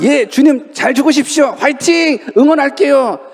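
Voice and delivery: high, bright mock cheer; mock cheerful disciple. Odd disciple cheering Jesus on to die, high and upbeat. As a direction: send him off like a cheerleader. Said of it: "어떤 제자가".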